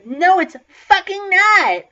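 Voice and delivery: loud, drawn-out shouted objection; outraged yell. Acted. Student standing up against censorship, outraged yell. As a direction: yell it slowly and forcefully. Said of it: "I yelled as I stood up".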